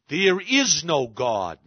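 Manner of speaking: a slow, loud cry of despair; anguished cry. Retold or imitated. Grieving father despairs.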